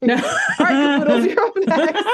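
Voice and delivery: high, loud call with a laugh in it; Major Glory. Retold or imitated. Major Glory calling Bubbles up by the wrong name, laughing call. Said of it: "Major Glory calls her caboodles".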